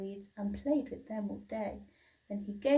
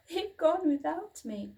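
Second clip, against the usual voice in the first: much higher, plaintive cry; wailing little dinosaur; 7 semitones higher, 4 dB louder than usual. A narrator's reading. Little dinosaur crying out when left behind. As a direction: wail it like an abandoned hatchling. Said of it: "he cried".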